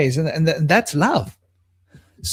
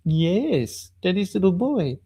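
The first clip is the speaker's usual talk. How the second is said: cooing to a pet; 4 semitones higher, 5 dB quieter than usual. Real, not acted.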